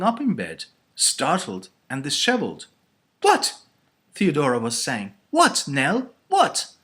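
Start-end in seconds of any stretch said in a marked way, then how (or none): 3.2-3.6 high, loud startled yelp. A narrator's reading. Theodora startled awake by shout. 5.3-6.7 high, loud, alarmed questions; startled questioning. A narrator's reading. Theodora startled, asks what is wrong.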